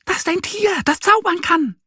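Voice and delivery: tiny high bird voices, full of excitement; excited chirping birds. A narrator's reading. Birds chirping the news from tree to tree, tiny and excited. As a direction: twitter it out like an excited little bird. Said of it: "Die Vögel zwitschern aufgeregt".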